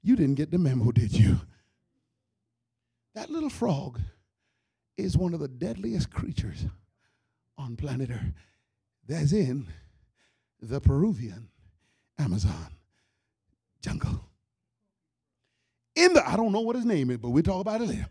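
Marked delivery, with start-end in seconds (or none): 0.0-1.4 low, tough little frog warning the snake; cocky frog voice. Acted. Comedian voicing the frog warning the snake, low and cocky. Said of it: "the frog looked at it and went". 10.6-14.2 Peruvian Amazon jungle dropping lower word by word. Acted. Dramatic repeated refrain.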